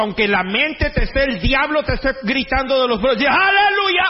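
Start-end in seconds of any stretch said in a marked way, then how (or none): 3.3-4.0 high, loud Aleluya shout; shouted hallelujah. Acted. Preacher exulting before the congregation, shouting high. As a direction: burst out with joy.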